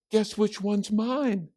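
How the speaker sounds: put-on high, girlish teasing question; proud Judy. A narrator's reading. Judy shows her painting to family, high and proud. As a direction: ask it high, like a proud kid.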